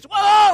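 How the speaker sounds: high, explosive yell of shock; long startled holler; startled loud cry. Retold or imitated. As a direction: imitate someone jolted awake, shouting. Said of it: "he said something loud".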